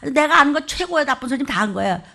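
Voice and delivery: normal talking voice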